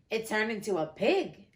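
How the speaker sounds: plain reading voice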